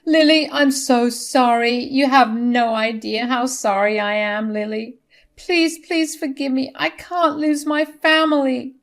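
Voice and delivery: high, pleading, tearful voice; distraught, weepy begging; tearful apology. A narrator's reading. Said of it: "She tearfully said".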